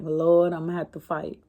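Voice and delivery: soft, low muttered thought to herself; inner worried thought. Retold or imitated. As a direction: say it under your breath like a private worry. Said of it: "This what was going through my head".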